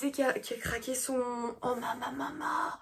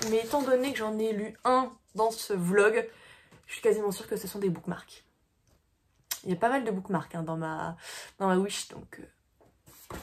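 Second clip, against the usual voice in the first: ordinary talk, nothing marked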